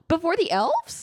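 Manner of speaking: high gasping surprise; shocked question. Real, not acted. Listener shocked at the dwarves' timing, high surprise. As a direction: gasp it in disbelief.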